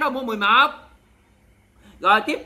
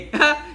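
quick, higher-pitched laugh; short amused laugh; 6 semitones higher than usual. Real, not acted. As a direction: let out a brief burst of laughter. Laughing at her own lottery joke.

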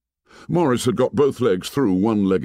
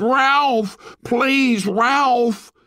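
loud, very high pleading shout; 4 dB louder, much slower than usual. A narrator's reading. Piggy shouts after Ralph as he runs off, high and desperate. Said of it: "Piggy shouted".

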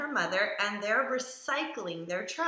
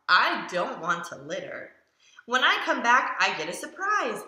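ordinary delivery

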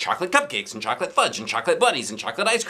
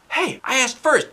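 complaining. Acted. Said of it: "Michael complained".

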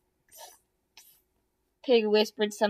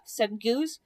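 ordinary delivery, nothing marked

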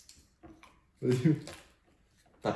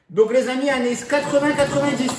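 excited; 8 semitones higher, 4 dB louder than usual. Real, not acted. YouTube intro hyping the theme.

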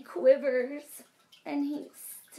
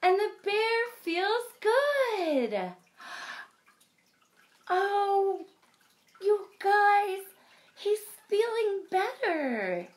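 higher and louder, joyful; delighted; 9 semitones higher, 6 dB louder, slower than usual. A narrator's reading. Reading to kids when Bear recovers, higher and joyful. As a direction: burst with relief for the bear. Happy turn in story.

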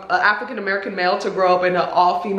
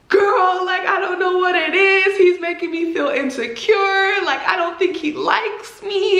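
higher, whiny retelling of her; imitating upset friend; 7 semitones higher than usual. Retelling Stacy crying in the closet, higher and whiny. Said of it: "in the closet crying".

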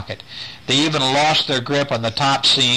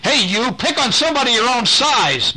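shriek; 12 semitones higher than usual. Acted. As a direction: yell up at the bully in the helicopter. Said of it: "Mandy shrieked".

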